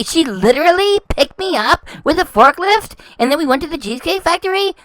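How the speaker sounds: a light put-on voice of the husband; example husband. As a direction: lightly play the husband telling it. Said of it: "Then you could say".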